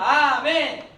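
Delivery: loud, full-force amen; big loud amen. Shown as a demonstration. Preacher shows students how to respond, big loud amen. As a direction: belt out a model amen. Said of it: "크게".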